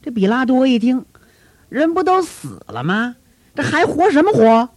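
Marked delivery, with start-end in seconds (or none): 1.7-4.7 scoffing official. Acted. Pilate scoffs at the priests.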